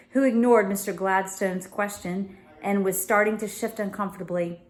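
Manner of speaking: plain reading voice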